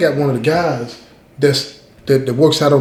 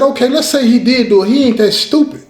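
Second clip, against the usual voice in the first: defensive; 8 semitones higher than usual. Retold or imitated. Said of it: "I'm getting real defensive".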